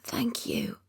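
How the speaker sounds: unvoiced whispered thanks. A narrator's reading. Weak patient whispering thanks to Ben. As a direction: breathe it with no voice. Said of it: "I whisper".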